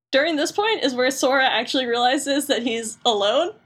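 ordinary delivery

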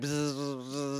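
soft buzzing bee noise; imitated bee buzzing. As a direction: buzz quietly like a bee. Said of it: "they're like".

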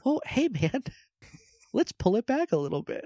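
high, gentle mock calming voice; mock soothing Mac. Mac calming Tyzon after he stomps the water, in a high coaxing voice. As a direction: coax a friend to ease off, hammed up. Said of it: "and Mac's like".

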